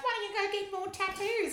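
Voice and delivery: high mocking old lady voice; imitating the old lady mocking her tattoos; high mocking taunt. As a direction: jeer up high at the speaker. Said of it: "she's like".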